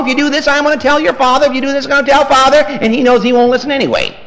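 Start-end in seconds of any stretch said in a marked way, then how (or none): 0.0-2.6 a hint of a nagging mother, fast and higher; mock nagging threat. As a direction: rattle off a mother's empty threat. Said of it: "She threatens all day long".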